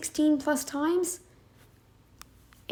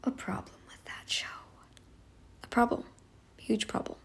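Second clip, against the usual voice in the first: sudden whispered aside; whispering; 12 dB quieter than usual. Real, not acted. Aside about the show.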